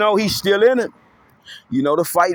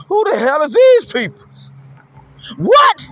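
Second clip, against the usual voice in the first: high, loud stunned shout; shocked disbelief, crying out; astonished outburst; 8 semitones higher, 5 dB louder than usual. Acted.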